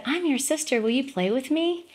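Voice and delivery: put-on a small girl inviting her brother to play; little girl voice. Son retells what his sister said in a light, high little girl voice.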